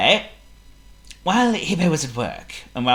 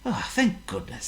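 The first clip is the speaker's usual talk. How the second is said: relieved; 6 semitones higher, 8 dB quieter than usual. Acted. Hippo relieved.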